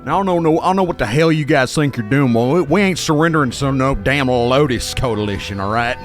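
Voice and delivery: folksy, determined village headman; down-home, defiant villager voice; village leader. Acted. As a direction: stand your ground as a weary local leader. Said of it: "a weary but determined man".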